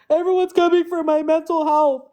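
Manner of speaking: mocking whine. Mocking the rival who bashed her, in a high whiny voice.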